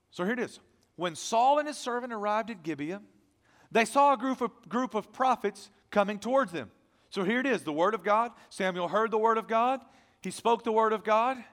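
loud, high, ringing pulpit voice; full-force preaching. Acted. Pastor preaching loudly to his congregation, full force. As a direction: preach it out to the whole church.

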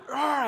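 high mock dog bark; spelt-out growl of a lunging dog; imitated dog snarl. Preacher imitating the dog still coming at him, mock snarl.

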